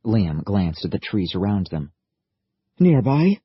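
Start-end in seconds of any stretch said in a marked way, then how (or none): 2.8-3.4 higher, louder rising alarm; sudden jolt of worry; alarmed question. Acted. Liam alarmed.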